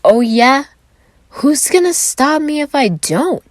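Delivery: put-on loud, high, slow drunken challenge; drunk slur. A narrator's reading. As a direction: slur a belligerent drunk comeback. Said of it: "he slurred".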